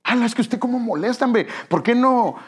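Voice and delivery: put-on child grumbling at a parent's request, irritated; mock annoyed child. Hammed-up irritated complaint.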